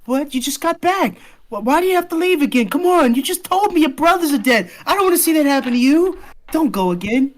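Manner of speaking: high, loud, rushed protest; desperate pleading. Acted. Girl begging bereaved friend not to leave again, desperate. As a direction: beg urgently, voice way up.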